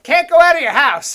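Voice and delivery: loud, high shouted warning; mock news shout. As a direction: yell it like a panicked newscaster. News people warning everyone.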